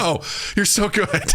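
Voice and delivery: high, gushing compliment; mock gushing praise. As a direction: coo it up high like a starstruck fan. Gushing at Streep's acting.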